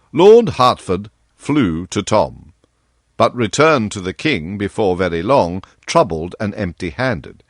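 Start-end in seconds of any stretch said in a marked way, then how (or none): none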